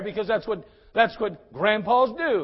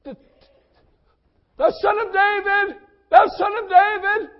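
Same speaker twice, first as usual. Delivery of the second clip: slow, high, drawn-out call that swells louder; desperate cry; 11 semitones higher, 6 dB louder, much slower than usual. Acted. Beggar searching for Jesus's direction, a high, slow wail. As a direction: stretch each word as if calling into the distance. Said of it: "he cry out in desperation".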